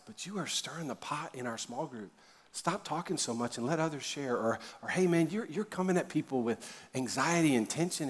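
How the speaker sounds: soft, gentle correction. Shown as a demonstration. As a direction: warn a friend quietly, with grace. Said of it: "this is always done in grace".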